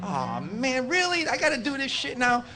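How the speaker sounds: high-pitched mock complaint; exasperated. Bobby has to prove himself to cook, exasperated. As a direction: whine at being put upon, pitch jumping up. Bobby's reluctant reaction in retelling.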